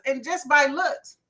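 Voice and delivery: ordinary delivery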